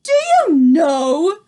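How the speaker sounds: Grand High Witch shouting at the audience; shouted. A narrator's reading. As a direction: hurl the question at them. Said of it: "she shouted at them".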